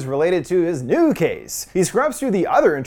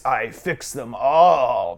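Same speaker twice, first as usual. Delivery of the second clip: very low, slow gravelly drawl; ominous gravelly voice, stretching the last word; 7 semitones lower, much slower than usual. A narrator's reading. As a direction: drag it out deep and gravelly. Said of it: "in a gravelly voice".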